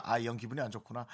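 note it quietly to yourself, unruffled; imitated unbothered aside; manager's calm acceptance. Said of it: "우리 매니저가 그렇게 저를 다루거든요".

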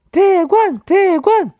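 high, sing-song calling voice; high playful calling. Pretend. Host imitates a singer calling out a name, high and lilting. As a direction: call out like the idol in a bit.

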